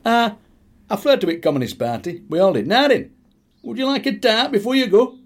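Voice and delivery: put-on high, loud old Yorkshire uncle; Uncle Jack's voice. Uncle Jack offers a tart before they go, high and loud.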